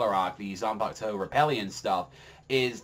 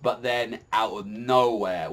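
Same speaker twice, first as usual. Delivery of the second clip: normal talking voice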